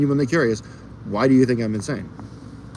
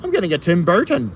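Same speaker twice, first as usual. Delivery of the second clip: jokey mock quote; 8 semitones higher, 3 dB louder, faster than usual. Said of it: "No, we don't".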